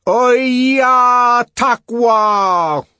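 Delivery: high, very slow, loud yell; drawn-out angry cry; shouted curse. A narrator's reading. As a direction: bellow a foreign curse, stretching each word. Savage shouts Zuni insult.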